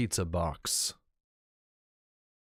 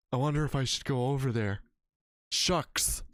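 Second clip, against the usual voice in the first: mock ghost hunter.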